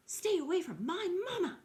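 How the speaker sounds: high, loud protective squawk of a yell; fierce gosling shout. A narrator's reading. Gosling defends his mother from bears, shouting high and loud. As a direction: leap in front of her and scream it. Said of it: "a loud squawk".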